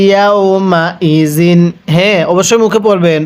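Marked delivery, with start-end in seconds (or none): 0.1-1.6 slow recitation. Shown as a demonstration. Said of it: "আমার সাথে পড়ুন".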